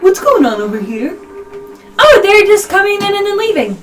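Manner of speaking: high, loud bug voice; chattering beetles. Acted. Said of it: "You hear in beetle speak".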